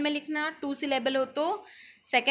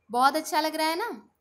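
ordinary talk

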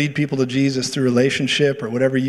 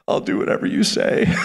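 breathy, voiceless imitation of a broken man; hushed, surrendering mock whisper; overcome whisper. Acting out man who broke down.

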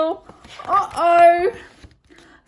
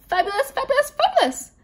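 climbing higher on each fabulous; exclaiming; 11 semitones higher, faster than usual. Acted. Zelda adding Phoebe's last chest feather, exclaiming high. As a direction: squeal it out, rising with delight. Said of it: "exclaimed Zelda".

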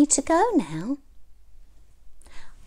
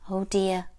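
ordinary delivery

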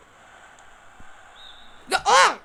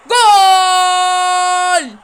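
goal shout; 3 semitones higher, 13 dB louder than usual. Real, not acted. Kid scoring in a football game, yelling goal. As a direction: roar the goal in triumph.

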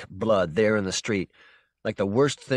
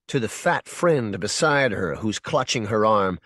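ordinary delivery, nothing marked

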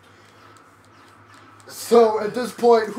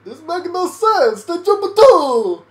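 put-on loud, high, slow hammy Italian accent; botched accent landing on cartoon Italian; drawn-out, pitched-up mock-Italian shaman; attempted shaman voice, accidentally Italian; 8 semitones higher, 8 dB louder, slower than usual. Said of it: "I'll do a shaman voice".

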